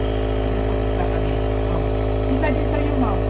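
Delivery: loud, calling over the nebulizer's hum. Real, not acted. Parent calling to sick child over nebulizer, loud.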